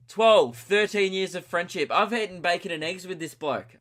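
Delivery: hammed-up betrayed outburst; mock outrage. Podcaster on best mate turning vegan, mock outrage.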